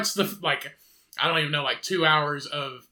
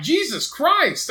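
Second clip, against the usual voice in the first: much higher, drawn-out slow exclamation of disbelief; amazed exclamation; 12 semitones higher, 5 dB louder, much slower than usual. Retold or imitated. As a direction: stretch it out, stunned. Amazed by diner food.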